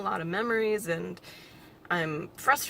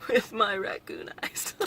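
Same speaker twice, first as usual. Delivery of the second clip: giggly whisper; 3 dB louder, slower than usual. Real, not acted. Joking about smeared mascara.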